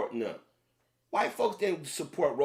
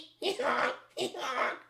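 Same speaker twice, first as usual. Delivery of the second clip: breathy laugh; 8 semitones higher than usual. Shown as a demonstration. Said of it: "I could sit right here and come up".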